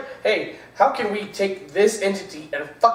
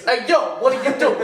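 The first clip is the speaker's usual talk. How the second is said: put-on Italian accent; 5 semitones higher than usual. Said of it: "your Italian accent".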